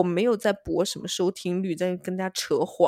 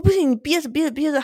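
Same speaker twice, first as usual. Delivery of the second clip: louder, firm hold it in; insistent refusal; 5 semitones higher, 6 dB louder than usual. Retold or imitated. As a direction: order him to hold on. Refuses roommate in retold scene. (坚决地命令他憋着)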